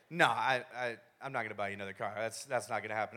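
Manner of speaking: low, softening dad refusal; lightly voiced dad. Dad refusing his injured son a car, lightly voiced.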